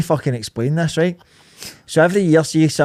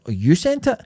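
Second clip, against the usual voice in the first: rising shocked question; surprised; 7 semitones higher, 4 dB louder than usual. Retold or imitated. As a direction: act shocked, pitch up. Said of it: "And he went".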